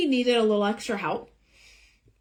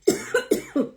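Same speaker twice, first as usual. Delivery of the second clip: breathy unvoiced coughs; choking cough; 13 semitones higher than usual. Real, not acted. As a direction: cough out the last of it. Choking on my saliva.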